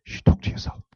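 whispered instruction. Shown as a demonstration. Preacher coaching the congregation, whispered. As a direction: say it under your breath.

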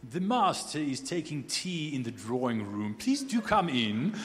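put-on stately British accent, slowing into a grand welcome; comic British butler ushering in a guest. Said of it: "inevitably with a British accent".

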